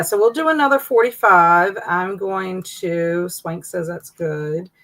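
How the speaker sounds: ordinary talk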